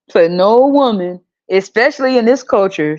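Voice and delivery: normal talking voice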